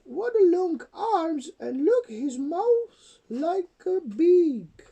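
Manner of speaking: slow, high, loud wail of complaint; grumbling mother dinosaur. A narrator's reading. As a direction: lament each fault in a high, drawn-out voice. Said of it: "And she grumbled".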